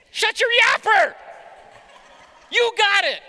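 loud, high mock snap; inner snarl. Husband's inner reply to his wife, loud mock snap. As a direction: bark it like a frustrated husband. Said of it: "that's what I'm thinking".

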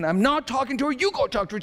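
high-pitched, hammed-up squabbling; mock adamant refusal. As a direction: refuse outright in an acted, squealed protest. Acted escalating marital argument.

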